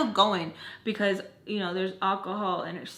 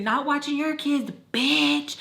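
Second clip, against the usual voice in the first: higher-pitched mock yell; retold scream; 5 semitones higher, slower than usual. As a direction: imitate screaming an insult through a wall. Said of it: "I'm in the room screaming".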